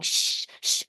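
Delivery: long hissed shushes; imitated shushing. Whole audience hushing one moviegoer, imitated shushing. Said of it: "the whole audience was going".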